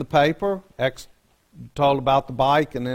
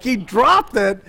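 mock disbelief; 7 semitones higher, 5 dB louder, slower than usual. Shocked at the news.

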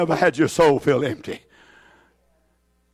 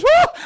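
ecstatic whoop; 4 dB louder than usual. Real, not acted. Preacher's ecstatic outburst.

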